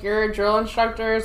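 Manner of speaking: normal talking voice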